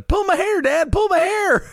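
put-on very high-pitched kid yell; mock child yelling. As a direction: yell it at your dad in a shrieky kid voice. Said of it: "like, yelling at him".

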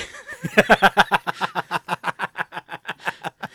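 loud, long laughing fit; real laughter. Real, not acted. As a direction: crack up at your own joke. Laughs at own outburst.

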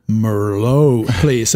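Merlot in a put-on American accent; drawn-out American murr-LOW; mock American pronunciation. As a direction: order Merlot with an exaggerated American drawl. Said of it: "Americans don't pronounce it Merlot".

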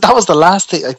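loud, high-pitched disbelief; shocked protest. Retold or imitated. Patient answering doctor's bombshell, loudly taken aback. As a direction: burst out, stunned by the news.

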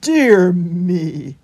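loud, drawn-out exclamation; wondering hedgehog. A narrator's reading. Holgate wondering at the rabbit's desertion, loud and drawn out. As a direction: marvel in a big character voice. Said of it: "wondered Holgate".

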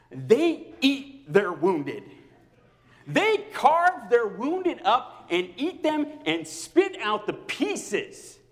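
dragged-out, hammered words; slow emphatic preaching. Real, not acted. Pastor warning the congregation, slow and emphatic. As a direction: lean hard on every word, slowly. Preaching about churches hurting people.

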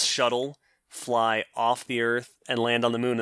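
normal talking voice

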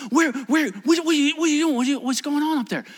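high, stammering, urgent questions; a flustered man demanding to know; acted alarmed questioning. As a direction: sputter questions with the pitch right up. Voices rich man's alarm.

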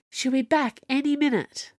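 high-pitched, urgent warning; frantic. A narrator's reading. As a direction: warn him as time runs out. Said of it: "said Camilla, frantic now".

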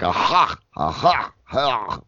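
throaty scraped "ha", Yiddish style; repeated "ha" with a guttural Yiddish h; Yiddish throat sound. Shown as a demonstration. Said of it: "I do fall into Yiddish a lot".

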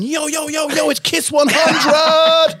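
high, loud 'yo yo' DJ shout, drawn-out ending; hyped-up radio persona. Retold or imitated. Presenter mocking his own demo at Kiss, hammed-up DJ hype. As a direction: stretch it into an over-the-top urban station ident. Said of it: "I just turned into somebody I'm not".

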